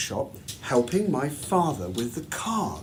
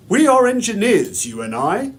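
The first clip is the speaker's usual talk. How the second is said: louder, higher proud declaration from the father; a father telling his son with pride, a touch louder; 4 semitones higher, 6 dB louder than usual. Acted. Father voiced in the narration.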